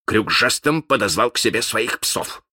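ordinary delivery

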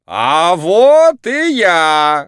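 booming, drawn-out showman cry; loud clown shout. A narrator's reading. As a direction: shout it to the whole arena. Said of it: "громко крикнул хозяин".

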